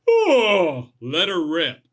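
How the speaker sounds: loud yawning oh, stretched out. Shown as a demonstration. Demonstrating a morning yawn to viewers, loud yawning. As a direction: let a big yawn carry the words. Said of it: "The next time you yawn".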